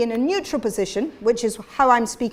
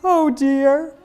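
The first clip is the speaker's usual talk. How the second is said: higher, louder "Oh dear", thin and sobby; thin fold voice; 4 semitones higher, 6 dB louder than usual. Shown as a demonstration. Teacher showing the group the thin-fold sound. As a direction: lift it into a high, sobbing "Oh dear". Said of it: "You're gonna repeat it back to me".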